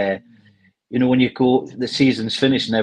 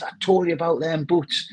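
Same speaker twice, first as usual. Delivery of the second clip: retold angry scolding; 7 semitones higher than usual. Ex-player mimicking his manager in dressing-room row, retold scolding. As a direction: imitate an exasperated ticking-off.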